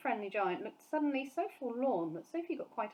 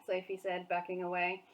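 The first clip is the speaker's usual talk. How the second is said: ordinary delivery, nothing marked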